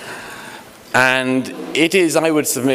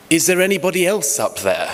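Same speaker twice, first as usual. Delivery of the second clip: higher, slightly laughing punchline; hopeful punchline; 7 semitones higher than usual. Retold or imitated. Falling man asks for someone else, higher and half laughing. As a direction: deliver the punchline with a hopeful lift. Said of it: "And he pauses and says".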